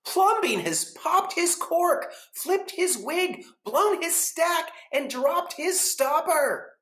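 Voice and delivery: high, loud and slow scandalized crowd; gossiping neighbors. Acted. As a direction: act shocked, drawing out each phrase. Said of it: "The people said".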